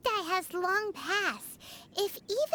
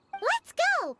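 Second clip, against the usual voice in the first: excited; 7 semitones higher, 4 dB louder than usual. Acted. Cheerful call to leave.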